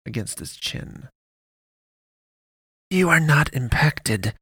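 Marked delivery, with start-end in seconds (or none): 2.9-4.4 higher, slow and cold; growled. A narrator's reading. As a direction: press each word like a threat. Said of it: "she growled".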